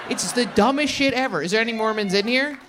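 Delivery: ordinary delivery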